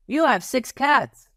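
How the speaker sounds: a confident wrong guess; child's voice. Acted.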